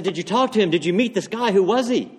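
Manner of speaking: urgent questioning. Acted. Pastor quizzing a man in the back row, urgently. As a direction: fire the questions off eagerly.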